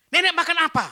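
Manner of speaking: high, very loud asking; acted astonished shout; shouted question. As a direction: holler it at granny. Shouting question to very old woman.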